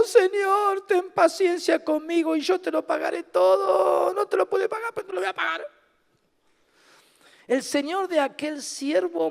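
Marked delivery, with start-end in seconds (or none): 0.0-4.1 high, loud begging plea; pleading servant. Acted. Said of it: "postrado le suplicaba diciendo". 4.1-5.6 pleading servant. Acted.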